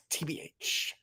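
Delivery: whispered aside TBH; whispering. Real, not acted. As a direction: breathe it out unvoiced.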